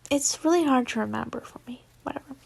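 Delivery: fast hushed aside; whispered aside. Real, not acted. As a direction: whisper it quickly to yourself. Aside to listeners.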